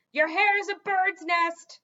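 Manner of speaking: loud, slow parting shout; shouted insult. A narrator's reading. Gargoyle shouting after the departing king. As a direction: shout it after him as the door closes. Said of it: "the gargoyle shouted".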